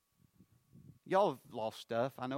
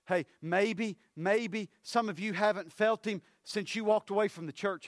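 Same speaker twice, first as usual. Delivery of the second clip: loud, higher-pitched preacher's call; preaching at full force; 8 semitones higher, 6 dB louder than usual. Acted. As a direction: drive it home to the congregation. Preacher raising voice in sermon.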